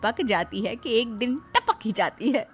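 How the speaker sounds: story narration. A narrator's reading. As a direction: tell the story calmly. Narrator ending.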